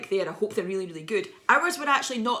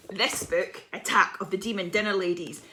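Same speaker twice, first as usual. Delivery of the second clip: ordinary talk, nothing marked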